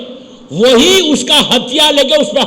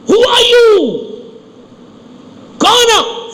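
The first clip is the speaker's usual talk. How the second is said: angry shout; 11 semitones higher, much slower than usual. Acted. Pharaoh yelling at the knock on his bedroom door, furious shout. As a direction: bellow it like an outraged pharaoh. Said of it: "اندر سے غصے میں چیخا".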